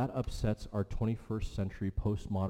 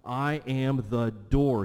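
I AM THE DOOR, slow and louder, every word weighted; slow, ringing stress on each word of "I am the door"; emphatic declaration; 3 semitones higher, 5 dB louder, slower than usual. Retold or imitated. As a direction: proclaim "I am the door" slowly and forcefully.

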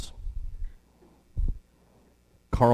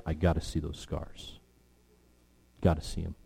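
low, soft voice of a grieving doubter; hushed, grave preaching; 3 semitones lower, 3 dB quieter than usual. Acted. Preacher speaks as Thomas needing to see the wounds, hushed. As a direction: drop low and quiet, letting pauses hang.

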